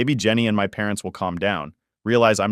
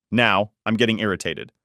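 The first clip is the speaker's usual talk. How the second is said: ordinary delivery, nothing marked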